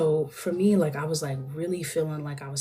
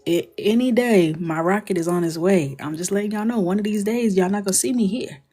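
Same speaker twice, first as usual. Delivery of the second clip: cheeky office announcement; 4 semitones higher, 9 dB louder than usual. Retold or imitated. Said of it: "make an announcement to the entire office".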